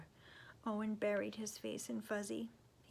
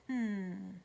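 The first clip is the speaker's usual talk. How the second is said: ordinary delivery, nothing marked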